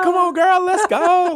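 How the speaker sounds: high-pitched hyped-up cheer; rally your friend at full pitch; excited shout. Retold or imitated. Acted excited reply, "we were just excited.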